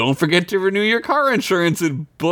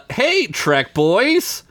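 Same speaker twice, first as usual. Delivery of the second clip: put-on slow, high Hey Trek boys; slow drawn-out greeting; 7 semitones higher, much slower than usual. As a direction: stretch the greeting out slowly. Reading email greeting slowly.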